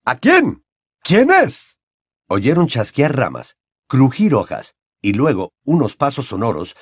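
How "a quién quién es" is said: high, loud urgent questions; urgent demand. Acted. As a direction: Mr Crouch demanding to know who, urgently. Crouch demanding answers.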